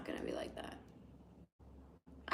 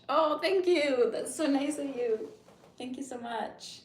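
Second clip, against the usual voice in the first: high, slow gushing thanks; delighted thanks; 6 semitones higher, 5 dB louder, slower than usual. Real, not acted. Streamer thanking viewer for makeup compliment, delighted. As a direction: gush over the compliment.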